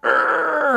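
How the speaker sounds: high-pitched mock "urgh" of frustration; frustrated growl. Israelites shaking fists at God, mock frustrated growl. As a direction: growl in exaggerated exasperation.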